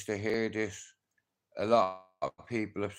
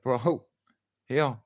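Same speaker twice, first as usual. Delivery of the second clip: demanding; 5 semitones higher, 5 dB louder than usual. Retold or imitated. Demanding to be shown.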